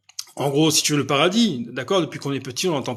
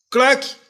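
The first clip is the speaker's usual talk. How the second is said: mock clack; 8 semitones higher, 5 dB louder than usual. Sound effect of sudden death.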